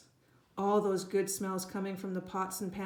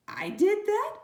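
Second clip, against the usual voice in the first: high, louder delighted question; surprised child; 13 semitones higher, 7 dB louder, slower than usual. A narrator's reading. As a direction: perk up in surprise. Toddler suddenly interested.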